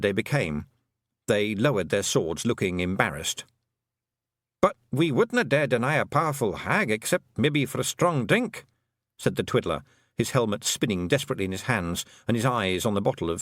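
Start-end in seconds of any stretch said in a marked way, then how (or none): 4.6-8.6 put-on high, lilting Scots-accented little man; a cheeky tiny clansman owning up; wee Scottish Feegle. Feegle chief confessing to the young witch, high Scots voice.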